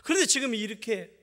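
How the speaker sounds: high, loud pleading protest to God; complaining prayer. Retold or imitated. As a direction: cry out at God like a wronged servant. Preacher quoting his past prayers.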